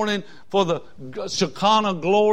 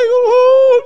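long high loud wail; imitated crying; 9 dB louder than usual. Preacher imitates kids crying in cabins, high drawn-out wail. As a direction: mimic children wailing in their bunks. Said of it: "I could hear 'em in the cabins crying".

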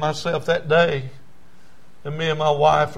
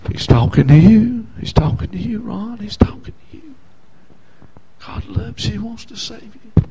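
hushed pleading; 7 semitones higher, 6 dB louder than usual. Retold or imitated. Voices inner pleading to himself.

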